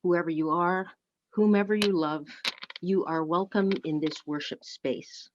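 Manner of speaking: ordinary talk, nothing marked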